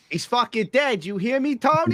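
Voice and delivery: high-pitched mock mobster; hammed-up New York gangster outburst; New York hitman. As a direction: put on an agitated mob caller, way up high. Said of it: "I sound like a guy trying to put hits on people".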